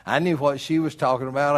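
real laughter in the retelling; laughing. Real, not acted. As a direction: chuckle while telling it. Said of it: "I couldn't keep from laughing".

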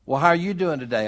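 mock friendly greeting, quick and loud; mock cheery greeting. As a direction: play a chirpy friend asking. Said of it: "count it all joy".

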